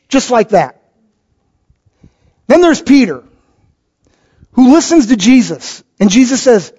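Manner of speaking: ordinary talk, nothing marked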